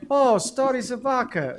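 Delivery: imitating an old cellmate greeting him; friend's greeting. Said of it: "So, so he went".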